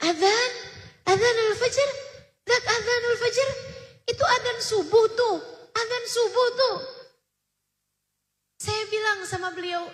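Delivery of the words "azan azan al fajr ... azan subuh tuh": imitating a muddled elderly father, pitched much higher; retold half-awake old man groping for the words; lightly mocking, dazed old voice; confused old man.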